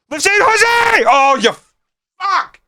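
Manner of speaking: high shout collapsing into a curse; anguished near-miss shout. Real, not acted. Fan watching his striker miss, high anguished shout. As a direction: scream then groan at a chance gone begging.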